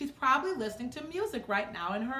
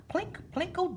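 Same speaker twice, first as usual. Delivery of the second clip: piano sounds; 8 semitones higher than usual. Acted.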